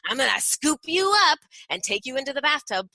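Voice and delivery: high, bouncy playful parent voice; singsong playful mom scooping up a toddler; mock playful voice. Shown as a demonstration. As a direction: tease the child like a game, up high. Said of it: "do a mock playful voice".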